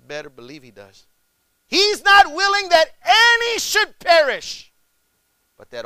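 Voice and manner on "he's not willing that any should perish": much higher and louder, slow and forceful; emphatic preaching. Real, not acted. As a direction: drive each word home slowly.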